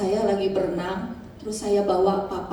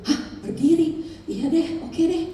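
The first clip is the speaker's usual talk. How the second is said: nervous laugh; 5 semitones higher than usual. Retold or imitated. Hesitant reply to God.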